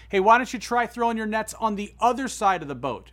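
mild storyteller's shout; calling out. Retold or imitated. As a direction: call across the water to a boat. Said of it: "he shouts over to them".

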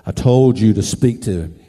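low, loud divine command. Retold or imitated. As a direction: boom it out deep, as God rebuking.

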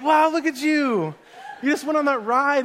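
high, loud retold marvelling; amazed praise. Parents to daughter fresh off the ride, exaggerated praise.

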